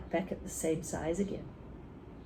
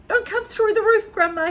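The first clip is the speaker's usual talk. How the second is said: high, loud desperate plea; praying; 14 semitones higher, 7 dB louder than usual. Acted. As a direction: beg it like a prayer, high and loud. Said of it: "he prayed".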